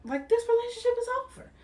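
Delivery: very high, loud and fast "this relationship is over"; exasperated outburst. Retold or imitated. Voicing the husband ending it, exasperated outburst. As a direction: fling the words out fed up. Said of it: "And it's just like".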